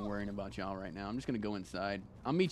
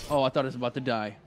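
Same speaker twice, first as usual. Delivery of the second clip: relieved rush; 7 dB louder, faster than usual. Real, not acted. Narrow escape in fight.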